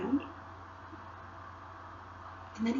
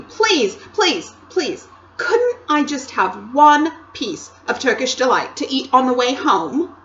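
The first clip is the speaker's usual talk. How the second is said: put-on much higher, louder boyish begging; Edmund pleading; 5 semitones higher, 6 dB louder than usual. Edmund begging the Queen for sweets, whining. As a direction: whine like a greedy boy.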